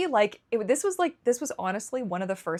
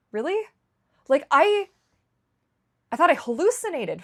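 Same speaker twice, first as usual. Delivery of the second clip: stunned disbelief; 9 semitones higher, 7 dB louder than usual. Real, not acted. Disbelief that a doctor offers help.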